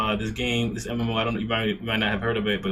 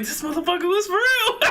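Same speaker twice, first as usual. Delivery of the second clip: high, laughing mock voice; cracking up while quoting the friend; laughing mock quote. Friend mocks the no-show buddy, laughing high.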